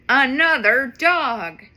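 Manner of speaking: usual speaking voice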